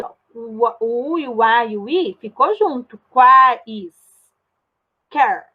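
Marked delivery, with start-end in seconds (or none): 3.1-5.5 "Quais", long pause, "Quer"; each syllable firm and isolated, "Quais... Quer"; teacher separating "Quais" from "Quer". Shown as a demonstration. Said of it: "Eu não separei".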